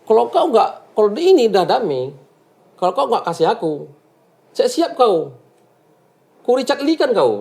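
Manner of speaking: mildly menacing imitation, pitched high; imitated intimidation; retold threat. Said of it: "Dia ngancam?".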